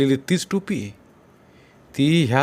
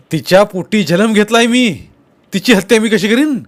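loud, higher outraged plea; indignant protest; 6 semitones higher, 7 dB louder than usual. Acted. Sonya swearing he never killed his mother, loud indignation. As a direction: defend yourself with wounded outrage.

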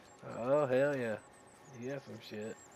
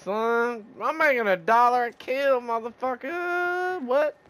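excited; 8 semitones higher, 4 dB louder than usual. Real, not acted. Gloating about money per kill.

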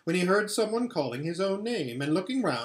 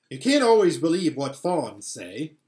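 know-it-all; 3 semitones higher, 8 dB louder than usual. A narrator's reading. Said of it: "trying to sound as if he knew".

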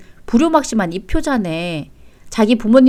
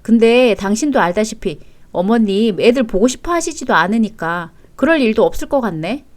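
usual speaking voice

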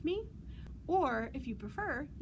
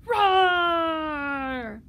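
one long, very loud roar; dinosaur roar; 3 semitones higher, 12 dB louder than usual. Pretend. Librarian roaring together with young listeners, loud roar. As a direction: let out a big playful roar, falling away. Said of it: "Let's roar together".

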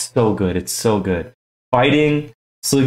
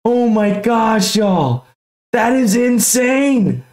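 high, thrilled disbelief; so pumped; 9 semitones higher than usual. Real, not acted. Collector still reeling from pulling the dream card, pumped. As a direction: stretch the exclamations out high, overjoyed. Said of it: "I'm so, so, so pumped".

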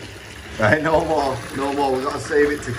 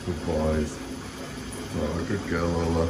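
low, soft praise; soothing praise; 8 semitones lower, 7 dB quieter than usual. Real, not acted. Dad praising Lola as she lies down, low and soothing. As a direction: lower and soften to soothe her.

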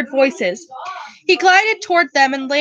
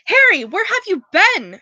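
higher squeaky Hermione; squeaking; 6 semitones higher than usual. Acted. Hermione greeting Harry, a mild squeak. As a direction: squeak it out anxiously. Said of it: "Hermione squeaked".

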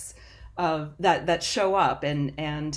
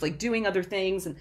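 ordinary talk, nothing marked

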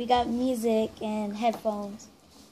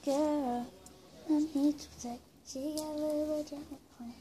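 softer whispered phrase; leaning in to whisper; 3 semitones higher, 9 dB quieter than usual. Real, not acted. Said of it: "we have to whisper".

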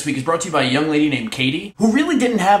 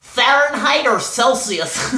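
high, drawn-out mocking query; exaggerated slow question, pitched up; mock questioner; 8 semitones higher, much slower than usual. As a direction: ask it slowly in a higher, put-on pitch. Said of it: "And then when he says".

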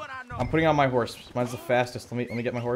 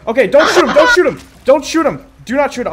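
high, loud plea to hold fire; urgent shout; 8 semitones higher, 6 dB louder than usual. Real, not acted. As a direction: yell to stop your teammates. Stopping teammates from killing target.

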